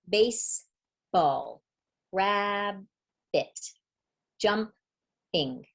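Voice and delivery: "baseball, rabbit, jumping" slowly, with a clear pause between syllables; split into syllables. Shown as a demonstration. Teacher showing what a syllable is, "baseball, rabbit, jumping" broken syllable by syllable. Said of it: "push out in one breath".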